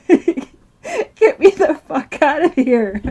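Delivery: loud, drawn-out demand through a laugh; slow, emphatic mock outburst with laughter; imitating dad's demand. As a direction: demand it loudly, half laughing, imitating him. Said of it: "he would be telling me".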